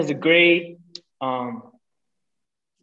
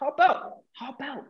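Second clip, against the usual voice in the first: high mock shout, fading softer; mock yelled order; 11 semitones higher, 5 dB quieter than usual. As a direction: replay the yelling, pitched up and dying away. Retold confrontation, ordering driver out.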